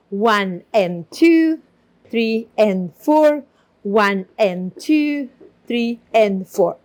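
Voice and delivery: slow, even count to the beat. Shown as a demonstration. Teacher counting while demonstrating the step. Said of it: "So we go again".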